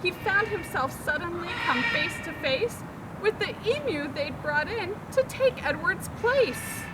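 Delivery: plain reading voice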